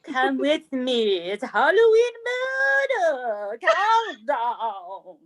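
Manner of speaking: very slow eerie announcer; spooky announcer. Acted. Host opening murder countdown, eerie slow. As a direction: draw listeners into the dark. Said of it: "spooky countdown intro".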